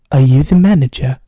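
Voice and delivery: put-on loud, low British accent; angry British man. Customer up close at the front desk, angry British accent. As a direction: confront them in a forceful British accent. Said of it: "this British guy was, like, so angry at me".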